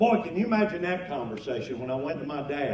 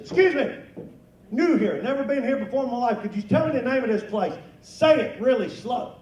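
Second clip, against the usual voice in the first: tourist asking directions; 6 semitones higher, 4 dB louder than usual. Retold or imitated. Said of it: "walked up to a kid behind the counter and said".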